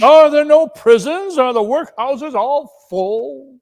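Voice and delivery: high, loud sarcastic retort; bitter mockery. The Spirit mocks Scrooge with his own words, loud and sarcastic. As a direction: throw his own words back at him.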